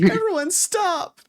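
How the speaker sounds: high, slow shout to stop; high commanding call. Acted. GM voices woman calling out.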